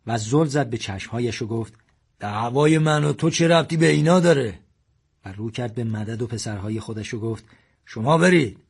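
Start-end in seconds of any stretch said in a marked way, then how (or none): 2.2-4.6 angry challenge. A narrator's reading. Confronting the gunman. 7.8-8.6 louder, firmer order; father ordering his sons to leave, firmly. A narrator's reading.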